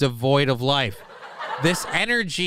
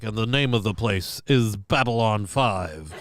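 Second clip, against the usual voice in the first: mock intro narrator; 4 semitones lower than usual. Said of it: "That's a quote from the intro".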